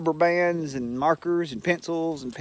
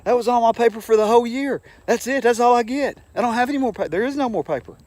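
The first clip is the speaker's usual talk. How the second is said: high, louder mock teacher voice, exasperated; 11 semitones higher, 6 dB louder, faster than usual. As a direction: squeal it out as the frantic teacher. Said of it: "he goes".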